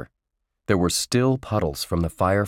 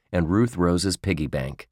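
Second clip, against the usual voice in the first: plain reading voice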